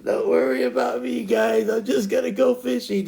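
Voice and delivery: high imitation of a breezy wanderer; carefree teammate. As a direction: call back high, brushing off their worry. Imitates Dragon heading off alone.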